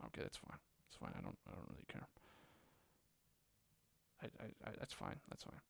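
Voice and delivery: barely audible whispered grumble; very soft, voiceless muttering to yourself; muttered mock acceptance. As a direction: mumble it under your breath, halting. Mock acceptance under the breath.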